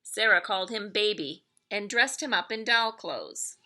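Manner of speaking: plain reading voice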